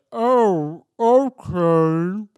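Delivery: drawn-out, distorted slow-motion voice; loud mock slow-motion reply. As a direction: stretch every syllable as if time slowed. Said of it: "it's, like, slow motion".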